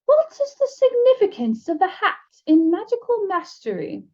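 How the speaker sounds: a high, trilling door knocker; lilting high eagle knocker asking a riddle. A narrator's reading. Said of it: "spoke in a high, trilling voice".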